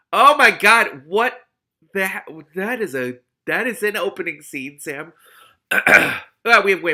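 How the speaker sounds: high, loud amazed exclamation; excited outburst. Real, not acted. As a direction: burst out in delight to Sam. Reader reacting after the chapter.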